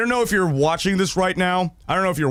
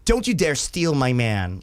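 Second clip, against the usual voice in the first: mock jealous girlfriend; 5 semitones lower than usual. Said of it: "And, like".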